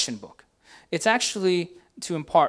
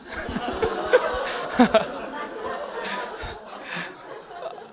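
a long real laugh at his own joke; breathy laughter; 9 semitones higher than usual. Real, not acted. Pastor cracking up at his own punchline, laughing hard. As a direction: break up laughing, airy and high.

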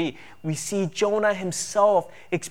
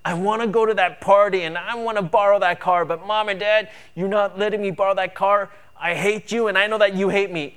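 lightly voiced whiny teen; a touch of teenage tantrum, higher; sulky teenager; 5 semitones higher, 5 dB louder than usual. Teen whining at mom and dad over the car, mild sulk. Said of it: "He's being that teenager who is just".

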